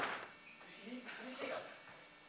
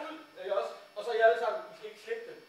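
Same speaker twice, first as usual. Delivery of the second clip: ordinary delivery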